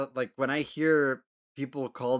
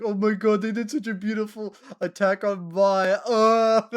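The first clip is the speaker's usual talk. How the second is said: gushing fan; 8 semitones higher, 10 dB louder than usual. Retold or imitated. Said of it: "I remember some people going like".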